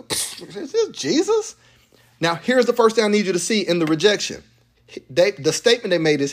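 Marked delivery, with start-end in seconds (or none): 0.0-1.6 higher, sneering question; mock disbelief. Hometown crowd doubting Jesus, mock incredulous sneer.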